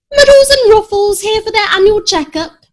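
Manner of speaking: high chirpy mother announcing the cats; cheerful mum voice. A narrator's reading. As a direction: sing it out to the receptionist. Mum declaring to receptionist.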